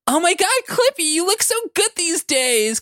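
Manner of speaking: very high, squealed delight; happy gushing. A narrator's reading. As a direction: gush in a high put-on voice. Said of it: "Clarky said happily".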